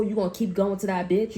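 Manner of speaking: ordinary delivery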